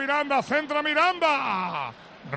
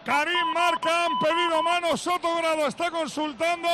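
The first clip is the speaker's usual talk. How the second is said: roaring goal call, high and loud; breakneck goal commentary; 4 semitones higher, 3 dB louder than usual. Real, not acted. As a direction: shout the goal over and over. Goal call on live radio.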